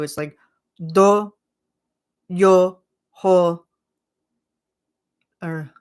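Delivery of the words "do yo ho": "Do, yo, ho" said louder, each syllable cut apart; spaced out syllables. Retold or imitated. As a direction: sound out every piece, "Do, yo, ho". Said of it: "you have to space it out".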